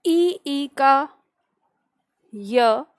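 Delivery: slow dictation with long pauses between phrases. Acted. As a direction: dictate word by word for note-takers, drawing out each sound. Dictates definition to class. (धीमे-धीमे, रुक-रुक कर श्रुतलेख)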